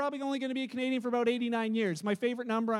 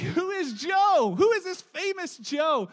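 higher, slow bewildered question; puzzled asking; 6 semitones higher, 5 dB louder, slower than usual. Retold or imitated. Visitor wondering why everyone calls him Joe, bewildered. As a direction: ask it baffled and drawn out.

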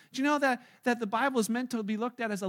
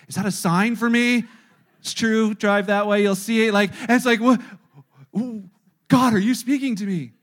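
much louder, hammed-up excitement; mock excited; 11 dB louder than usual. Preacher mocking a seeker who reads road signs, loud. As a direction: shout it like a revelation.

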